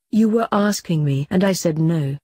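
usual speaking voice

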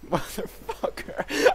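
slow breathy whisper of a curse. Real, not acted. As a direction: hiss it under the breath. Quiet curse after a laugh.

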